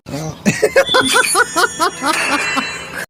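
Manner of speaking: high, light laugh; a high giggle at the punchline; laughter. Real, not acted. Laughing at Santa's punchline, high and light.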